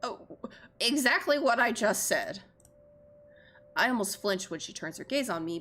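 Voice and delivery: ordinary talk, nothing marked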